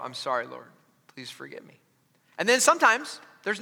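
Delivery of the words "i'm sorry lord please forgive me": whispered prayer. Retold or imitated. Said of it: "I'll just go".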